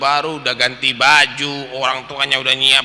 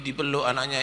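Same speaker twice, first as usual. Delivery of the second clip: usual speaking voice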